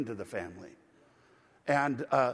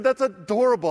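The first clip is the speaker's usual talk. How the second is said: delighted exclamation; 4 semitones higher, 8 dB louder than usual. Retold or imitated. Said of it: "I said".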